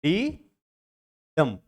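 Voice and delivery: light "डी", long gap, firmer "डंप"; stress on second beat. Shown as a demonstration. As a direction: separate the beats, stressing "डंप" over "डी". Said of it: "डंप पे ज्यादा है".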